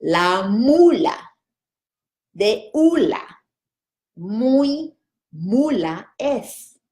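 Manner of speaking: very slow, syllable by syllable; slow practice. Shown as a demonstration. Teacher repeating the twister very slowly for students. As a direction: drill the twister at half speed. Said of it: "One more time".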